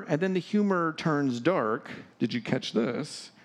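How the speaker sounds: normal talking voice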